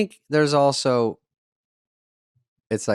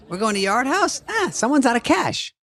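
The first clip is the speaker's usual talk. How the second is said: manager's voice pitched much higher, teasing; mocking manager; 13 semitones higher than usual. Manager teasing the comic about being short of cash, in a high mock voice. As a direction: tease him in a high, jeering voice. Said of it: "He goes".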